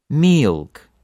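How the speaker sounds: "milk" with a long stretched ee; long, emphatic "milk"; lengthened Russian vowel. Shown as a demonstration. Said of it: "another example with milk".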